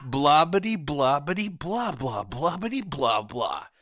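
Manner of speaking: loud, dismissive mock chatter; mock babble. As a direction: rattle off meaningless babble mockingly. Mocking someone's empty chatter.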